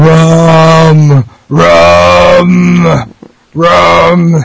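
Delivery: groaning undead voice, shouted; pirate zombie. Pretend. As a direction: moan it like a hungry zombie. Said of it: "you know what a pirate zombie sounds like".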